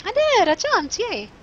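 high, delighted squeal; excited cheer. Real, not acted. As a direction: celebrate a small win in a high voice. Celebrates landing a jump.

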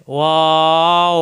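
ওয়াও held long through rounded lips; rounded mouth. Shown as a demonstration. Teacher asking learners to practise along, rounded waw. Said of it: "মুখ গোল হবে".

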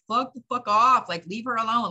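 shouting the crowd away to protect her; angry shout. Retold or imitated. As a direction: tell them off at full volume. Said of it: "it's making me angry in the core of me".

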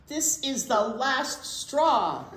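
hammed-up exasperation; mock fed-up. Norman fed up.

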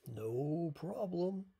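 big, slow booming elephant; loud, drawn-out storybook boom. A narrator's reading. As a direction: boom it out loud, stretching each word. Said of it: "boomed Elephant".

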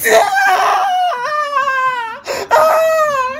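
high loud yell. Retold or imitated. Boy yelling as he pees on his sister. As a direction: let out a big yell.

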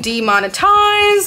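drawn out slow and very high; sing-song joke. Pretend. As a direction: stretch "Demonetized" slowly in a high singsong. Jokey refusal to answer.

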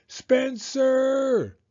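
high, loud drawn-out call; yelled. A narrator's reading. Mom calls her son on her way upstairs, yelling. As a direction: mom yelling a name up the stairs. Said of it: "she yelled on her way upstairs".

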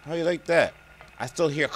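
ordinary talk, nothing marked

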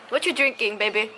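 ordinary talk, nothing marked

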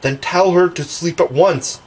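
insist, just short of a command; mildly bossy; almost ordering. A narrator's reading. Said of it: "John almost ordered".

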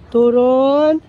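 normal talking voice

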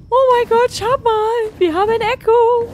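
loud, high, excited outburst; thrilled. Real, not acted. Player tells viewers they have found Echo, thrilled. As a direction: burst out at a long-wanted find. Thrilled at finding wanted creature.

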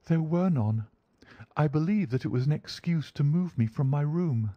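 high, earnest feminine voice; young woman's high character voice; lady client. Acted.

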